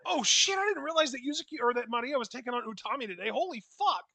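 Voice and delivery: high excited exclamation; sudden shock, voice jumps up; surprised. Real, not acted.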